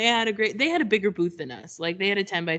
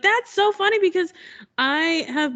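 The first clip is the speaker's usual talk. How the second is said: amused friend; 9 semitones higher than usual. Retold or imitated. Friend Val laughs at story.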